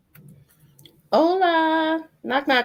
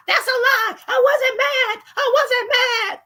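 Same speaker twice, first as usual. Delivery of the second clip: high indignant shout; 5 dB louder, slower than usual. Real, not acted. Streamer shouting denial at the panel host. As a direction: yell the denial, outraged.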